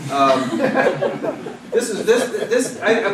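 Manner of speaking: giggling through a quick intro; laughing. Real, not acted. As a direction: laugh it out, rushed. Laughing while introducing the poem.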